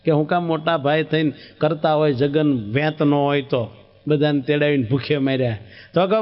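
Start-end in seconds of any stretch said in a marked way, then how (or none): none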